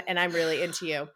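ordinary delivery

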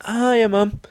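high, innocent hello; sudden sweet greeting. Retold or imitated. Angry man suddenly spots his mum, sheepishly sweet. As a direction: switch to a meek, friendly greeting.

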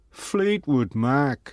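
very slow, drawn-out reveal. Acted. Rock star showing the powder, slow put-on voice. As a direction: stretch it out in his voice.

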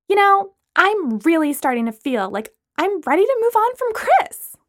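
chirpy and curious; Lana. Acted. Lana asking Brooklyn about the drink.

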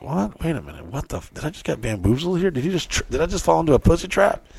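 hushed, high-pitched baffled inner voice; mock bewilderment. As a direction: imitate a girl realizing she's been tricked. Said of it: "they'd be very confused".

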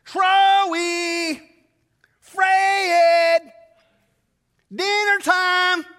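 high, loud sing-song Southern mom call; mom calling kids. Retold or imitated. Southern mom calls the boys home for dinner, high sing-song holler. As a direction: sing it across the neighborhood like mom. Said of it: "she's Southern, so she'd go".

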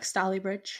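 Stalybridge said the northern way, STAL-ee-bridge; short flat A in the first syllable of Stalybridge; northern pronunciation. Shown as a demonstration. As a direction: model Stalybridge plainly as a local would. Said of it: "as a northerner, it's like".

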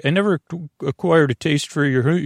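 ordinary talk

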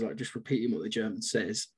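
ordinary talk, nothing marked

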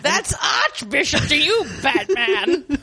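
mock villain snarl; lightly hammed-up supervillain. Villain voicing the Duchess.

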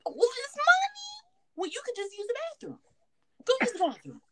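high mock baby voice; high whiny impression of the baby; imitating the baby. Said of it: "He's like".

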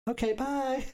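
high, sweet telephone voice; sweet polite phone voice. Retold or imitated. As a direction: mimic her polite phone manner. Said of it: "It's a laugh that would go with your phone voice".

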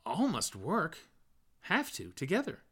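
high, rising insistence; Bloom's earnest voice. A narrator's reading. Bloom urges Stephen, high and earnest. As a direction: urge that all must work.

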